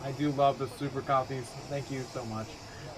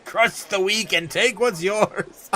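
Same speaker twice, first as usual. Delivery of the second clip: villain reading; 4 semitones higher, 5 dB louder, slower than usual. Retold or imitated. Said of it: "reading chat".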